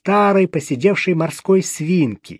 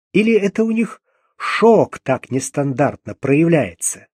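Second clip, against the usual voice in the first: ordinary delivery, nothing marked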